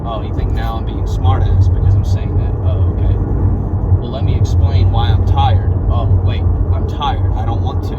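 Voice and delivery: loud, heated retort; imagined argument. Retold or imitated. Arguing with his brother in his head, loud and heated. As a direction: snap back loudly and sarcastically.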